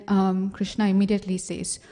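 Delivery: ordinary delivery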